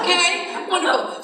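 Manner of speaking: high sarcastic cheer with a laugh; mock delight. Driver stuck in Wilkes-Barre, sarcastic cheer.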